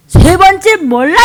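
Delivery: high, loud, slow denial; forceful playacted disavowal; emphatic denial. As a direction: call out the denial, stretching each word. Acts third denial with congregation.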